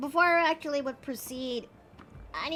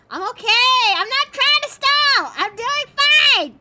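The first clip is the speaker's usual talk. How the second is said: loud, high, laughing denial; panicky insistence; 9 semitones higher, 10 dB louder, slower than usual. Real, not acted. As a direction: insist you're fine, rattled.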